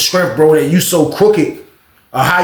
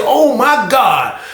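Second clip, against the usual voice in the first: stunned; 7 semitones higher, much slower than usual. Retold or imitated. Stunned reaction retold.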